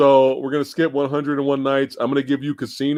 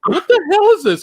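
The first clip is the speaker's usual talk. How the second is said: high-pitched, louder mock shock; incredulous outburst; 5 dB louder than usual. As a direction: squeal it in comic disbelief. Retelling shock at chatty monster.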